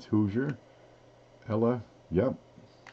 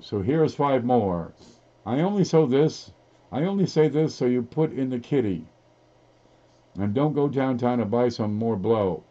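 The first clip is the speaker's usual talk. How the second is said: hammed-up singsong verse reading; lilting mock poetry reading; poem recitation; 3 semitones higher, 3 dB louder than usual. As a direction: recite the message as if it were poetry. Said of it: "Please read this like a poem".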